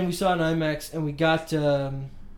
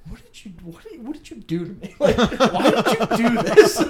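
mock teary, half-laughing plea; lightly voiced crying mom, breaking into laughter; imitating mom crying; 6 semitones higher, 7 dB louder than usual. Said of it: "and she's crying".

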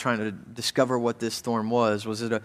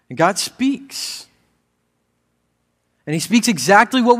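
louder, higher, driving sermon voice; impassioned preaching; 5 semitones higher, 9 dB louder than usual. Acted. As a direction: preach it out to the room with rising fire. Preaching builds to full force.

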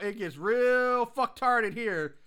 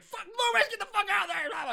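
mock yell; 13 semitones higher, faster than usual. Podcaster retelling the sheriff yelling at Loomis, mock yell. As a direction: shout it like a panicked sheriff. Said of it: "Bragg's like".

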